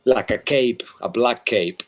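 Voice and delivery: ordinary delivery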